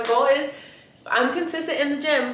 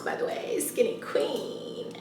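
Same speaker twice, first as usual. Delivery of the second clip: whispered brag; 3 semitones higher, 9 dB quieter, slower than usual. Real, not acted.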